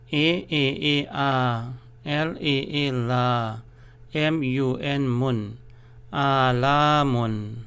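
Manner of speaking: M A A ma drawn out slowly; teacher's slow spelling; slow spelling drill. Shown as a demonstration. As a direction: spell each syllable slowly, stretching the long a. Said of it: "there must be a stress".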